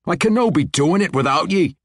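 dwarf voice pitched high and loud in protest; a dwarf pleading on the edge of desperation. Acted. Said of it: "on the edge of desperation".

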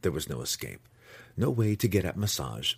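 ordinary delivery

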